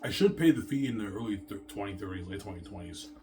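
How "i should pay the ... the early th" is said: normal talking voice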